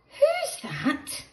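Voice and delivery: high, drawn-out who's that; high suspense question. A narrator's reading. Narrator asking the children, high and curious. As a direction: ask it high with wide-eyed suspense.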